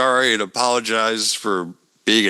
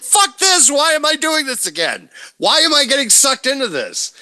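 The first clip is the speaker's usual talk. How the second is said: exasperated outburst; 13 semitones higher than usual. Retold or imitated. Seth griping about being dragged back in, high exasperated yell. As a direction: blurt it out like you're sick of the whole thing.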